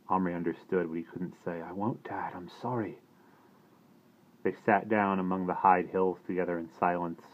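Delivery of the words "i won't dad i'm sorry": soft, hushed apology; quiet apology. A narrator's reading. Son apologising to his frightened father, soft and hushed.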